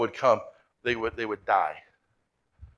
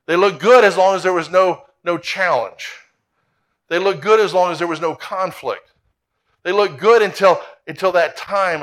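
preaching with force; 3 semitones higher, 5 dB louder than usual. Acted. Pastor preaching to congregation.

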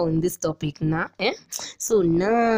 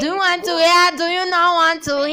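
Swahili accent; 11 semitones higher, 6 dB louder than usual. Shown as a demonstration. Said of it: "I have to do my Swahili accent".

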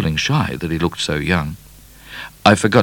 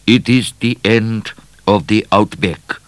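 put-on accented, pronouncing explorer voice; foreign explorer; 4 semitones higher, 4 dB louder than usual. A narrator's reading. Explorer answering nervous Midnight, accented voice.

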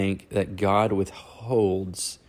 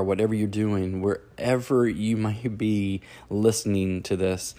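ordinary talk

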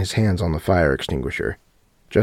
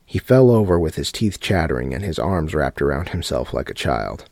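ordinary delivery, nothing marked

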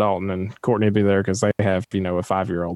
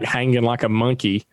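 usual speaking voice

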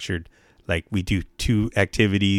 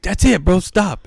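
high, louder stop order; mock commanding yell; 11 semitones higher, 5 dB louder, slower than usual. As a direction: shout him down. Counselor breaking up fight.

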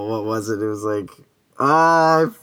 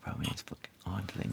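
muttered joke; 3 semitones lower, 13 dB quieter, faster than usual. Retold or imitated. Acting out a silly reply.

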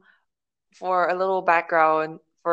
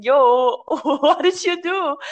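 high, drawn-out retold question; playful, incredulous high-pitched call-out; mock disbelieving confrontation; 7 semitones higher, 4 dB louder, much slower than usual. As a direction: confront a friend in laughing disbelief. Retells confronting friend by phone.

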